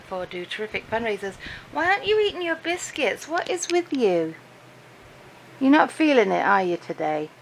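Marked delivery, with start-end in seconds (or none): 1.7-7.3 fond pet-talk to a dog that won't eat. Real, not acted. Talking to her dog.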